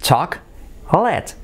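"Chocolate" slowly split as "CHAK... let"; slow model of "CHAK-let"; correct native pronunciation. Shown as a demonstration. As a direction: break "Chocolate" into two syllables, stretched. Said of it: "y es chocolate".

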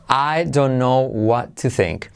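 slow, unlinked "I don't know what to think"; careful, slow model before linking; separate words. Shown as a demonstration. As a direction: say each word apart, with a clear D in "don't". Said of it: "Pero al hacerlo unido".

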